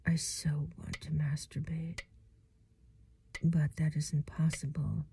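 soft breathy ASMR whisper. Acted.

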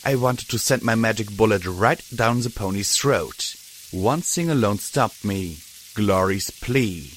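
ordinary delivery, nothing marked

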